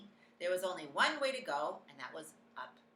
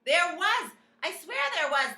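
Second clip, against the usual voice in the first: loud high insistent shout; indignant shout back; 12 semitones higher, 14 dB louder than usual. A narrator's reading. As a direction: protest it loudly. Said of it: "the man was shouting back".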